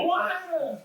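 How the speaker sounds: mock baby cry, squealed very high; high mock "wah" of a crying infant; imitated baby wail. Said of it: "And they go".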